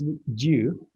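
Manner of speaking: "would you" as "wood-joo"; joined "would you" with a clear J; linked J sound. Shown as a demonstration. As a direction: turn D plus Y in "would you" into J. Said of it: "this becomes J".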